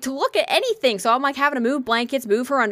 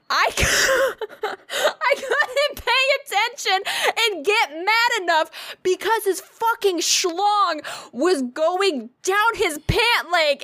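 high-pitched, helpless laughter through the words; can barely get it out for laughing; 9 semitones higher, slower than usual. Real, not acted. Telling a friend about the doctor, laughing helplessly.

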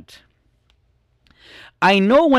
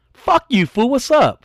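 mock fight challenge; 10 semitones higher than usual. Little brother picking a fight with his brother, mock challenge. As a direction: square up to the older brother.

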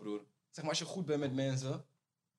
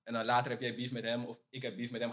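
normal talking voice

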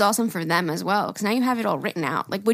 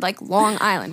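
usual speaking voice

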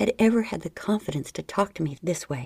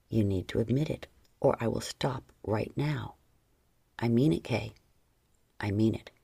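firm ultimatum; 8 semitones lower than usual. Acted. John threatening to stop.

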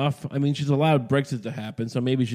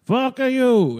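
higher, louder mock Italian retort; mock Italian accent; 11 semitones higher, 5 dB louder than usual. French troops taunting the British, louder mock Italian accent. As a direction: bark it out like a hammed-up Italian. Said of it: "It's more Italian, I guess".

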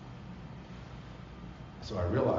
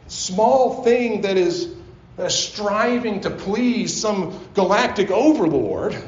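higher, louder, slow and emphatic; full-force preaching; 13 semitones higher, 8 dB louder, slower than usual. Acted. Pastor preaching to the congregation about God's love, impassioned.

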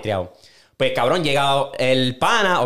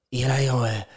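ordinary delivery

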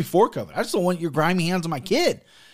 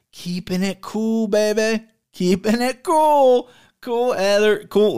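slow, higher, drawn-out cool; playful cool; 6 semitones higher, 3 dB louder, slower than usual. Real, not acted. Assuring viewers he keeps cool, slow and higher. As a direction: stretch it out smugly.

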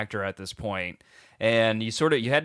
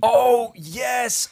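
high, bursting with recognition; high-pitched excited outburst; 11 semitones higher, 4 dB louder than usual. Real, not acted. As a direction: blurt it out loudly as it clicks. Suddenly recognizes the kid being described.

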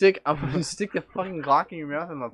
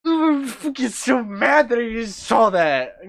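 loud, slow, high mock rage; hammed-up angry wail; mock furious whine; 10 semitones higher, 8 dB louder, much slower than usual. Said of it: "I was like".

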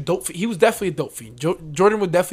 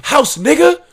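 mocking dope fiend; 10 semitones higher, 8 dB louder, slower than usual. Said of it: "It's like".

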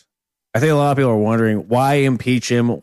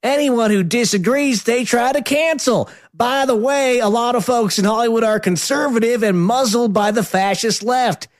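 mocking politician voice; 10 semitones higher than usual. Reading a politician's tweet mockingly.

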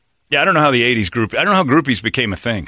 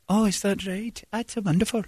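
higher, softer gushing reply; singer's reply; 12 semitones higher, 6 dB quieter than usual. Retold or imitated. Singer answering a fan, higher and softer. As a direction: coo it high and soft.

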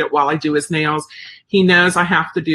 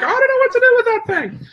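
high, strange puppy-dog voice for the service dog; dog's voice; 3 dB louder than usual. Retold or imitated. Dog owner voicing her service dog's puzzlement, high and put-on. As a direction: voice the dog's confused thoughts, pitched way up. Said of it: "and he was like".

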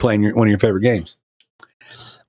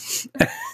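a soft, very high squeal of a giggle; real giggle, high and breathy; high squeaky giggle; 10 dB quieter than usual. Real, not acted. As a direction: let a tiny high laugh escape. Dad cracking up.

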